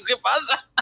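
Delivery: high, cheeky wife voice; the wife gloating, squeaky; high mock wife. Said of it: "Y la mujer". (la mujer burlona con voz aguda)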